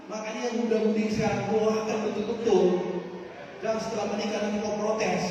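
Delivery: loud, driving sermon voice; fiery preaching. Acted. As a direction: hammer the point home to the congregation. Preaching at full force.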